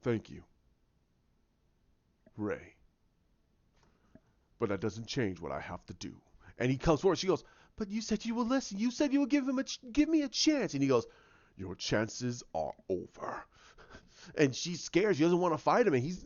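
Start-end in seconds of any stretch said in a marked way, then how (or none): 0.0-6.1 low, soft acted villain; quietly resolved imitation, pitched down. Villain answering Rey before their fight, low and quiet. 7.8-10.7 high, fast mock plea; pleading heroine. Rey pleading with villain for a chance, high imitation. Acted plea of scared woman. 11.6-13.4 slow, low, softened threat; menacing villain voice. Retold or imitated. Villain refusing Rey's plea, slow low menace.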